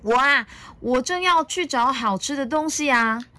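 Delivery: put-on high, cheerful child-dinosaur voice; playful, lifted storybook child voice; giggly little dinosaur. A narrator's reading. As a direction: answer brightly as an eager little one. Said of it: "小良笑嘻嘻地说".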